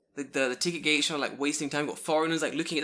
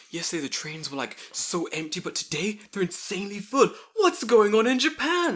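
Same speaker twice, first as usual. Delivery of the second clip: mock bewilderment, climbing higher and louder; baffled tourist; 7 semitones higher, 5 dB louder than usual. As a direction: wail it like an overwhelmed newcomer. Imitating a baffled visitor to Japan.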